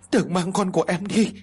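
put-on mother wailing over her dying child; bursting into tears. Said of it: "Vợ của anh Bình òa lên khóc".

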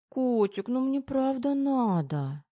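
high, drawn-out wheedling; whiny insistent pleading. A narrator's reading. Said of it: "не унималась девушка". (капризно-просящим тоном)

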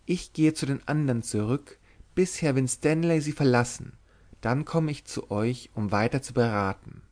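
ordinary delivery, nothing marked

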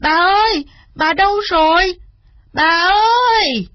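put-on high, loud child crying out for grandma; panicked calling. A narrator's reading. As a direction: call out frantically, stretching the last call. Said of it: "Cậu bé hoảng hốt".

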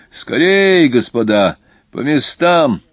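loud, high, urgent order; shouted call. A narrator's reading. Conductor orders passengers to their seats, shouting. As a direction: call out to hurry everyone along. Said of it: "крикнул кондуктор".

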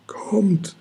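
high, unvoiced frightened whisper; trembling breath. A narrator's reading. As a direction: breathe it out, scared stiff. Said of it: "haucht Orakelchen zitternd".

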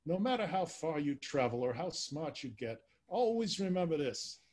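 New York accent, bad on purpose; worst Damon Runyon accent. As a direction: open like an old New York gambler. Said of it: "read in my worst, uh, Damon Runyon accent".

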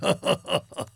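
big dragon's pleased laugh; dragon chuckle. Acted. Dragon laughs at his own joke. (behäbiges Drachenlachen)

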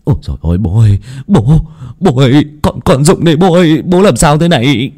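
panicked. Acted. Son seeing his father pass out, panicked calling. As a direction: call out to your father in mounting alarm. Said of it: "thì hốt lắm".

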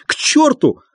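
high, wailing curse; tearful shout. A narrator's reading. Doctor running after the visitors, tearful shout. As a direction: cry it out, near tears. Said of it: "крикнул он плачущим голосом".

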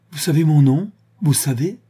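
amazed, voice lifting; astonished question. A narrator's reading. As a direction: ask in sudden amazement, pitch jumping. Startled woman hears her name spoken.

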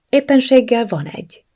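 high, eager reply; eyes lighting up. A narrator's reading. Friend has a suggestion for woman, perking up eagerly. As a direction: perk up with an idea to offer. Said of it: "csillant fel a szeme".

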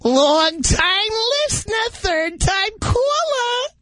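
very high, very slow put-on voice; hammed-up catchphrase. Caller plays up his radio credentials, high hammed-up drawl. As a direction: draw every word out in a mock high pitch.

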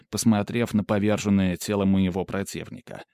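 ordinary delivery

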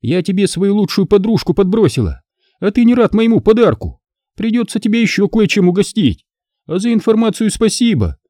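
high, gloating taunts; openly mocking Alisa. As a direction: jeer at him, delighting in it.

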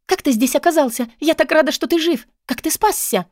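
put-on high-pitched excited young woman; eager, high, delighted questioning; overjoyed Katarina. A narrator's reading. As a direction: gush with relief and wonder. Voices Katarina, overjoyed at rescue.

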